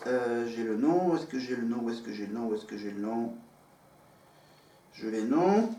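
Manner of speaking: low, soft repeated mumble, trailing down; muttering to oneself. Real, not acted. Host hunting for a name in the notes, muttering to oneself. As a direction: mutter it under your breath while searching. Searching notes, talking to self.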